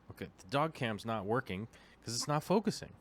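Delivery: ordinary delivery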